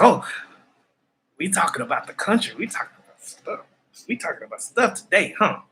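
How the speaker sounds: excited dog voice. Pretend. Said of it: "He be like".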